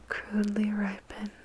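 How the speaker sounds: ordinary delivery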